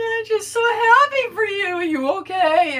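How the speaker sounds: high, loud, overjoyed and tearful; emotional mother. Retold or imitated. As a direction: gush with joy to your daughter. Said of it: "I said".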